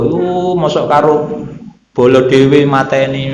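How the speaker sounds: lightly acted tearful reluctance; mild mock lament. Killers who cannot bear killing their own people, mildly lamenting. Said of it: "Tapi semuanya nangis, Pak, yang bunuh".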